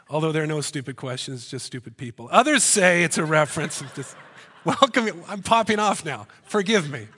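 ordinary delivery

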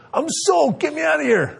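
put-on high-pitched whiny kid pleading; soaked kid. Son in the shower begs dad to let him out, high and whiny. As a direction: squeal it like a drenched little boy. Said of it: "He's like".

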